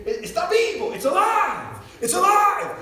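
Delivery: high-pitched, loud imitated cries; yelling. Acting out the preacher with his shaking bag, yelling. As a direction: cry out a wild announcement to a crowd. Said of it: "he's, he's yelling".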